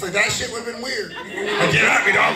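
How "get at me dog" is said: imitating [name]. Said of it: "if Obama had D M X's voice".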